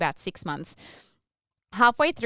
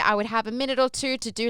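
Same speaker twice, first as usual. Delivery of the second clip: normal talking voice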